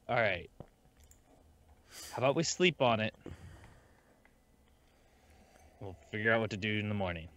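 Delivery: a touch of weary resignation; resigned sigh. Acted. Player's character proposing to sleep on it, mildly resigned. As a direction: say it as if sighing, lightly resigned. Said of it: "Just, like, sigh, be like".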